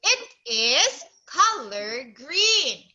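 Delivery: "It is color green", each word drawn out; slow model sentence. Shown as a demonstration. Teacher correcting a child's answer, slowly modelling it. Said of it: "Oh, you say".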